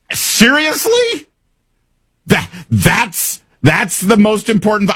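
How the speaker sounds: high-pitched, drawn-out disbelief; incredulous. Real, not acted. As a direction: balk at it, voice shooting way up. Incredulous at Politico's framing.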